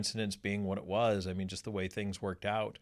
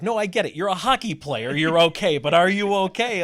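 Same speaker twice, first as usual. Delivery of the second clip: much higher and louder, stressing "hockey" and "are"; loud, pitched-up imitation of an insistent friend; insistent retold quote; 9 semitones higher, 12 dB louder than usual. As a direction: push the question hard like a worried friend. Tim insists he's hurt.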